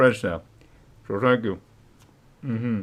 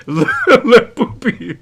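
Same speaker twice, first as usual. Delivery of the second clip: slow laughing high voice; 11 semitones higher, 5 dB louder, slower than usual. Real, not acted. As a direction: giggle through the words. Reading chat comment, laughing.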